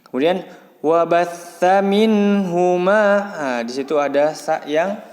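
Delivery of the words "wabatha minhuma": "wabatha minhuma" slow, with pressed "tha" and held n; pressed recitation. Shown as a demonstration. Teacher models the verse for pupils, slow pressed recitation. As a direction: recite "wabatha minhuma" in measured, drawn-out syllables. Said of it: "bertasdid sama ditekan".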